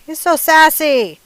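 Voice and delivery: high, loud and drawn out; slow and loud, savoring the word sassy; loud sassy exclaim. Acted. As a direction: belt it out with gleeful sass. Exclaiming about rooster's attitude.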